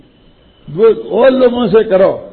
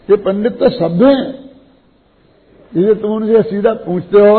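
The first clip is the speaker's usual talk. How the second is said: ordinary delivery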